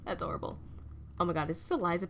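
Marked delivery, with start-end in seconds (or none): 0.1-0.6 whispered through laughter. Real, not acted.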